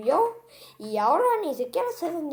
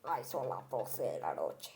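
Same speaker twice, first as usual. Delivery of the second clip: much lower and softer, slow; sleepy aside; 12 dB quieter than usual. Real, not acted. Monster notices the late hour, low, soft and slow. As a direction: sigh it out late at night.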